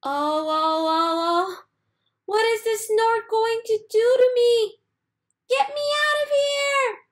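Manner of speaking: very high, loud panicky cries rising to a shout; scared chick carried off; frightened chick. A narrator's reading. Baby bird panics as the snort lifts him, rising to a shout.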